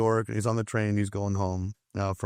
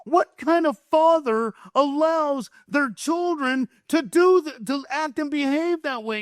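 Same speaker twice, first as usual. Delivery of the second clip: imitated outraged onlooker, pitched very high; mock-furious high-pitched rant; indignant outrage; 13 semitones higher, 3 dB louder than usual. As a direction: act out righteous disbelief, high and emphatic. Voices man's indignant outrage.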